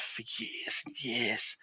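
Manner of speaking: slow, whispery stretched words; drawn-out storytelling. A narrator's reading. Telling of the man's long wandering, slow and whispery. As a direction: let each word trail out slowly.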